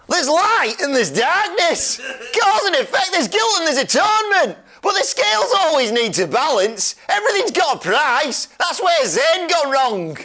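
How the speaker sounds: a high, theatrical put-on voice for the game line; mock-dramatic villain read, pitched high; hammed-up quote reading. Quizmaster acts out game quote.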